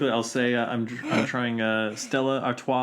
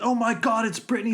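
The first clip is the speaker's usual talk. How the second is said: excited; 11 semitones higher, 3 dB louder than usual. Retold or imitated. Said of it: "He goes".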